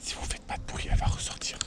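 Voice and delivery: souffle, sans voix; chuchotement en se cachant. Real, not acted. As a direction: chuchote pour ne pas effrayer la loutre. Attendent la loutre sans bruit. (chuchoté, caché près de l'eau)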